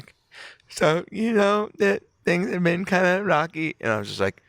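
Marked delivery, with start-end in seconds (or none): 0.7-3.8 slow, high weepy whine; mock sobbing voice; imitated tearful confession. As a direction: drag it out high like someone crying. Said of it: "just starts crying".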